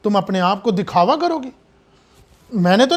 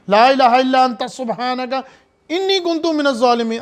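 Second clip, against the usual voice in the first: Quranic supplication recitation; 3 semitones higher than usual. Acted. Quotes Yunus prayer.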